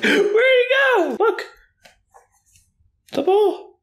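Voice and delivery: high, slow coaxing voice. Real, not acted. Owner coaxing her puppy toward the ball, high baby talk. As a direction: coax the puppy sweetly.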